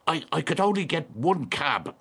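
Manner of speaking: high, stammered report after exertion; puffing from exertion. Acted. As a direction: blurt it out as if winded. Man arrives puffing from the cold.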